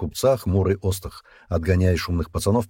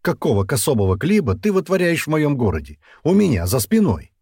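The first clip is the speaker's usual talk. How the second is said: high, raised scolding; angry outburst; 8 semitones higher, 5 dB louder than usual. A narrator's reading. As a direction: tear into him, pitch up. Ostakh rages at Dian. (гневный разнос высоким голосом)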